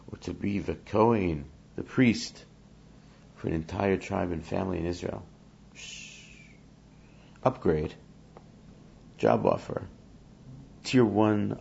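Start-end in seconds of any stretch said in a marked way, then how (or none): 5.7-6.2 soft hushing shh, finger to lips; unvoiced shush. Priest is urged to keep quiet: soft shush. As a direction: hush the room with a breathy shh.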